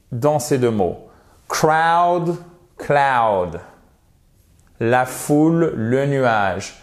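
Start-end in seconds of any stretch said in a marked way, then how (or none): 1.5-3.6 CROWD and CLOUD with a long open OW; same diphthong. Shown as a demonstration. Teacher models two English words, matching diphthongs. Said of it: "la même diphtongue".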